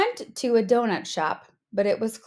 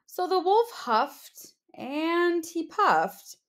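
slow huffing; 7 semitones higher, slower than usual. A narrator's reading. Narrator acts huffing slowly.

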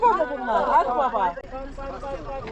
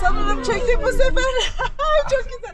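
excited; 10 semitones higher than usual. Real, not acted. Excited after catching shot.

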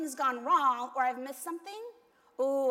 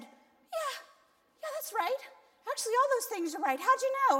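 higher, louder and faster, more and more amazed; amazed agreement; 10 semitones higher, 6 dB louder than usual. Retold or imitated. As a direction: gush it out, stunned he knew it all. Amazed he guessed everything right.

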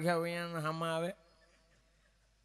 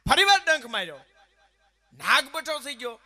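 loud, slowing as the snake gives up; dramatic loud telling; 6 semitones higher, 8 dB louder than usual. Acted. Storyteller booming as the snake goes limp. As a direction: boom it out then let it sag.

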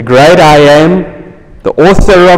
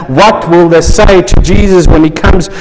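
usual speaking voice

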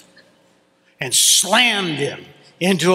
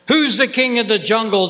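higher, fast storyteller's roar; roaring lion; 6 semitones higher, faster than usual. A narrator's reading. Lion with a big ego challenging the monkey, roaring. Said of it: "the lion roared at the monkey".

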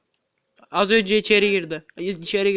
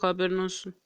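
whispering; 2 semitones lower, 9 dB quieter than usual. Real, not acted. Whispered aside to teammate.

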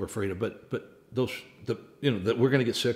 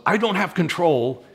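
loud, high outburst of helpless panic; anxious cry; 6 semitones higher, 8 dB louder than usual. Retold or imitated. Pastor voicing the congregation's fear, a loud, high panicked cry. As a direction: blurt it out as if control just slipped away.

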